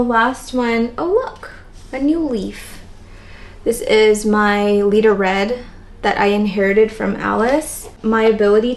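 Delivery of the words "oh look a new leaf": pleasantly surprised. Real, not acted. Spots new leaf on plant.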